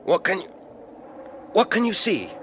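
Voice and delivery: high, loud, urgent asking; urgent anxious cry. Acted. Alfred urgently calling up to Piers on the wall. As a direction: call up anxiously.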